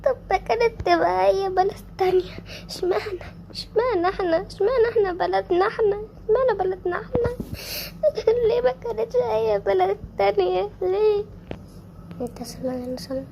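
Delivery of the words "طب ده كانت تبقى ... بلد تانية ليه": sobbing, pitched up and louder, pleading to God; tearful despairing cry of why us; crying lament. Acted. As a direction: wail it through tears, asking why us. Mother wails at the disaster.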